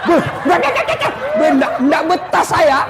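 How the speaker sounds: mock terrified jinn yelling he'll run; panicked stammer. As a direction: stammer and shout like a jinn fleeing. Frightened jinn stammering, fleeing.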